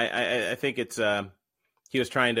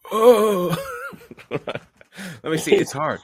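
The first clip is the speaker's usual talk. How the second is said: put-on higher, far-off old voice trying it out; old man warm-up; 13 semitones higher, 10 dB louder, much slower than usual. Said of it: "Can you do a Joe Biden impression?".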